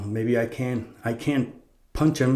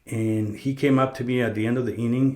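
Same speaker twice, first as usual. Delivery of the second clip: ordinary talk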